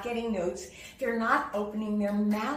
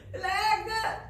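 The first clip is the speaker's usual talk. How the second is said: choked blah; 10 semitones higher than usual. Shown as a demonstration. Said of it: "get the sound choked".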